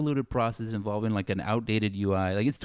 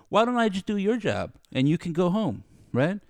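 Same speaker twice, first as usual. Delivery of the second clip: higher, sneering sarcasm at the boss; mock the boss's logic with rising heat; sarcastic rant; 8 semitones higher than usual. Telling off his boss, climbing sarcastic rant. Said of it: "we got Adam on a tear".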